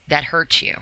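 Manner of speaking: ordinary talk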